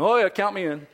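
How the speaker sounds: loud, high-pitched chiming in; eager going-along. Acted. Someone afraid to seem stupid joining in, eager agreement. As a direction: agree quickly to keep up with the bold one. Follower feeling pressed to agree.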